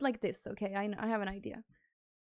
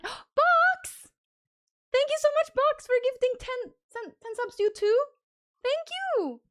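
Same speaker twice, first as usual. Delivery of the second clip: much higher and louder, gushing; overjoyed thanks for ten gifted subs, high and loud; thrilled shout of thanks; 10 semitones higher, 3 dB louder than usual. Real, not acted. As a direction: squeal the name, then pour out thanks. Gasps at another ten-sub gift.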